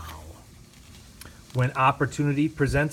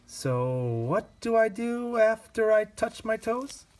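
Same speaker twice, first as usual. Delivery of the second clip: higher, a lightly voiced confused kid asking; puzzled child; 9 semitones higher than usual. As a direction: sound lost, like a boy unsure of the next move. The child character asks, puzzled.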